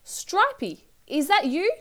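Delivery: high, hopeful call. A narrator's reading. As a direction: call out high for a lost pet. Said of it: "she heard a growl from the little pink house and called".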